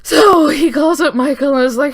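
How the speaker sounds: can't stop laughing mid-story; cracking up while telling it. Real, not acted.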